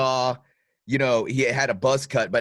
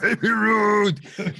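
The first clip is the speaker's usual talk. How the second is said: put-on very slow, much higher drawn-out "Baby"; hammed-up Chunk impression; Chunk's "Baby Ruth"; 10 semitones higher, much slower than usual. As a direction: drag out "Baby" high like Chunk calling. Echoing the Goonies quote.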